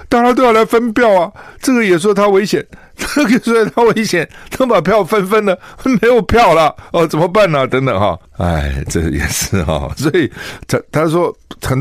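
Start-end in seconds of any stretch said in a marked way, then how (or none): 0.1-7.7 much higher, louder, worked-up retort; exasperated rant. Retold or imitated. Telling a fellow candidate on the train why it's hard, exasperated and much higher. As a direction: throw up your hands over the split votes. Explaining vote splitting, exasperated.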